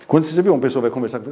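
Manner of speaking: ordinary talk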